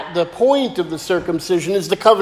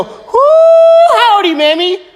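high whooping hello to grandma; hammed-up cheer for a grandma's birthday; excited greeting; 9 semitones higher, 5 dB louder than usual. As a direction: whoop it out, thrilled to see her. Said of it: "I'm gonna go".

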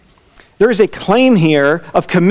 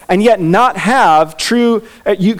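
ordinary talk

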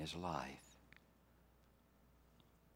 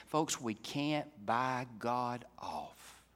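normal talking voice

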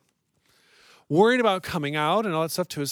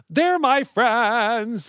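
loud; 8 semitones higher, 6 dB louder, much slower than usual. Acted. Opening bit, loud slow delivery.

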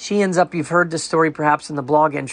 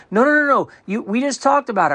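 insistent neighbor; 7 semitones higher than usual. Retold or imitated. Neighbor insisting they just talked, higher imitated protest. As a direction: mimic her cutting him off, pitched up.

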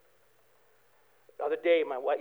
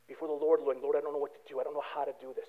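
hushed, much softer plea to the Lord; quiet prayer; 9 semitones lower, 15 dB quieter, faster than usual. Retold or imitated. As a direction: murmur the prayer low and soft, almost to yourself. Quiet prayer voiced in sermon.

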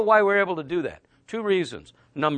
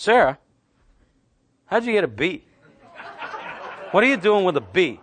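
teasing scold. Retold or imitated. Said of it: "I cringe".

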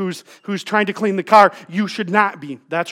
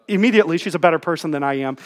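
normal talking voice

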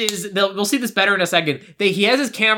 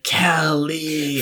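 low, weak, drawn-out call; mock-feeble murmur of an injured man; feeble injured dad; 4 semitones lower than usual. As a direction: call out faintly as if barely conscious. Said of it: "Feebly stirring".

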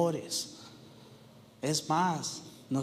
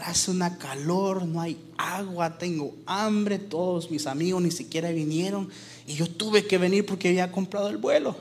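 higher-pitched, dismayed complaining to yourself; exasperated self-questioning; 5 semitones higher than usual. Acted. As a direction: act out a young man's mounting lament. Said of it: "se pregunta".

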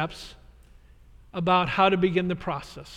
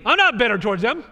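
louder, raised protest on not; defensive denial; 4 semitones higher, 7 dB louder, faster than usual. Retold or imitated. Staff member denying bitterness to pastor, defensive. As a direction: snap back defensively. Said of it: "Listen to the tone of your voice".

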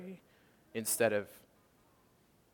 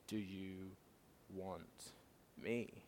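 much softer, spaced out word by word; tender quiet question; 3 semitones lower, 13 dB quieter, much slower than usual. Retold or imitated. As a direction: offer it gently, almost under the breath. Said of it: "and say".

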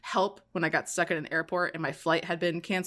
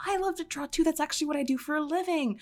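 gushing sweet talk; 9 semitones higher than usual. Retold or imitated. Retold sweet-talking the mechanic.

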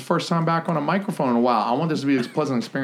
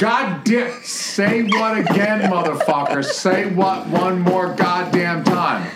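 drawn-out, high-pitched movie tirade; Samuel L. Jackson; 7 semitones higher, slower than usual. Acted. Flubbing a film scene for laughs, Samuel L. Jackson impression. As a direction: stretch it out as a hammed-up threat. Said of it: "trying to do Samuel".

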